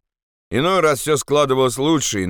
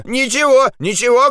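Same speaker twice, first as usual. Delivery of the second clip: high, louder cry of alarm; shouting; 14 semitones higher, 4 dB louder than usual. Acted. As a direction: hurriedly cry out to calm the guards. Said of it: "крикнул он". (испуганный крик)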